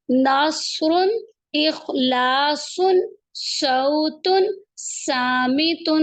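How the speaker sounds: each word said very slowly with a hard ص; slow ص examples. Shown as a demonstration. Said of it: "یہ سواد ہے".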